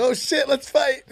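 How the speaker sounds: high, slow jolt of waking up ready to fight; startled fired up. Retold or imitated. Fighter waking from a knockdown, startled and eager. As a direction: snap awake and want to brawl. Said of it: "I was like".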